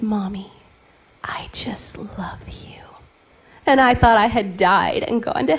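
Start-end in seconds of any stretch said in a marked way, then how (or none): 0.0-2.8 put-on whispered child. Son's tender words whispered.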